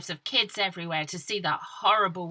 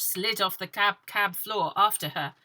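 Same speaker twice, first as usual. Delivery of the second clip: usual speaking voice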